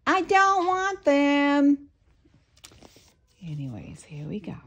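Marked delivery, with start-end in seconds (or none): 0.1-1.9 much higher, slow, loud huffy voice; Squidward voice. Retold or imitated. Nanny imitates Squidward refusing the gift, high and slow. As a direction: sulk it out like Squidward. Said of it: "He would go".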